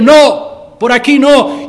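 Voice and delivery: a preacher voicing a father who blocks the path; stern parent saying no. Parent stopping a child.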